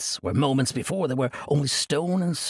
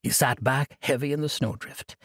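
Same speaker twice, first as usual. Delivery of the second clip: usual speaking voice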